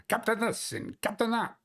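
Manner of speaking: mocking imitation in a Scottish accent. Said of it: "your stupid Scottish accent".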